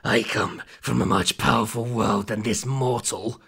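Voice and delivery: menacing demon line read straight through. A narrator's reading. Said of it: "it's meant to be".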